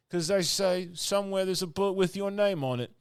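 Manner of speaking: high, loud dim-witted servant voice; simple servant. Retold or imitated. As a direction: say it proudly, like it's obvious. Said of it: "And he goes".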